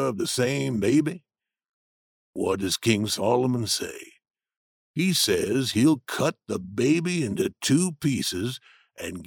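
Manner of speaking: low steady Jim arguing his point; Jim's voice. A narrator's reading. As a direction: voice the older man, grounded and sure. Jim speaks in story.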